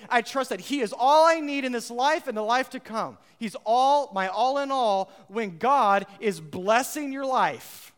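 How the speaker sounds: loud, high preaching voice, slowing at the end; full-force preaching. Acted. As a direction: preach it out to the whole church.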